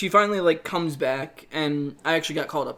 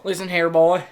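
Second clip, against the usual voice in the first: put-on southern accent; 2 semitones higher, 7 dB louder than usual. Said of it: "developed a southern accent there".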